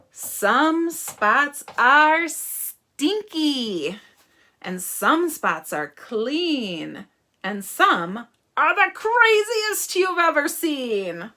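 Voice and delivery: slow, loud, lilting picture-book reading; story reading. Acted. Teacher reads about silly spots to children, animated story reading. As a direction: stretch the describing words with big swoops for children.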